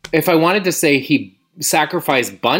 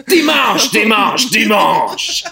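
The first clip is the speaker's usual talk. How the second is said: monster truck ad; 5 semitones higher, slower than usual. Pretend. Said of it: "the French monster truck announcer".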